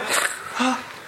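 soft breathy mock gasp; a quiet, unvoiced gasp of shock; whispered gasp. Shocked reading of the ksuva.

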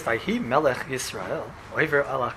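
low, droning recitation of scripture; dull, lifeless reading. Shown as a demonstration. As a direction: read it as if you hate it. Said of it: "If you read Navi like this".

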